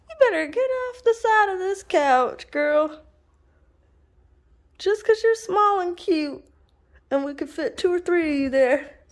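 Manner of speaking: high sing-song talk to the dog; high pet voice. Real, not acted. Telling the puppy she must wait, high pet voice.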